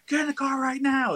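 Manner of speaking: very high, strained mock scream; retold scream. Freaked-out friend ordering the others into the car, imitated scream. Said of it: "He's all screaming and yelling at us".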